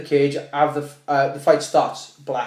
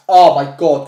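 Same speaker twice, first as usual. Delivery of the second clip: mock alarmed cry; 12 dB louder, slower than usual. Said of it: "she went like that".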